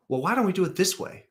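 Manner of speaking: "Well, why don't we do it this way?" pitched high as a suggestion-question; leading question tune. Shown as a demonstration. Manager nudging the team, "why don't we do it this way" in a high questioning tune. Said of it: "Question mark".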